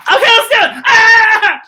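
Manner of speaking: high loud cheer; excited shout. Acted. Host cheering that the kids are ready, loud. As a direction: yell with glee to start.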